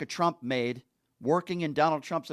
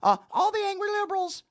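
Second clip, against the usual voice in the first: high, fast whiny mock voice; mocking James Comer; faster than usual. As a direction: squeak it out like a flustered politician brushing things off. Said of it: "This guy's so stupid".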